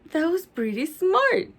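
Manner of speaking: ordinary delivery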